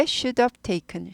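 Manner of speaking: should have taken with have sounding like of; reduced should have. Shown as a demonstration. Teacher demos the reduced pronunciation to students. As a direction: slur have into of. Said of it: "of처럼 들립니다".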